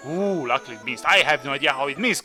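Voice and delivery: ordinary talk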